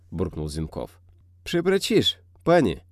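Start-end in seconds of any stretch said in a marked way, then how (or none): 1.4-2.8 higher, apologetic mumbling; guilty mumble. A narrator's reading. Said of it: "виновато забормотал змей".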